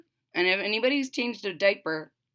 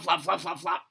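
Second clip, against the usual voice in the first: ordinary delivery, nothing marked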